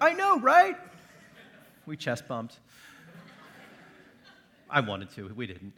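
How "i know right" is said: loud, very high-pitched gushing; mock excited agreement. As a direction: play up giddy agreement, voice leaping up. Acts out his delighted agreement.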